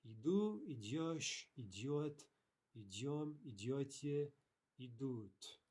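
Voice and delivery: Иду starts high and each form after it falls; conjugation drill. Shown as a demonstration. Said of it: "pretty easy".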